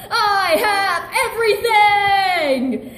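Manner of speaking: gloating villain crowing his win. Acted. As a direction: crow it out in triumph. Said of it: "Mister Snuggles crowed".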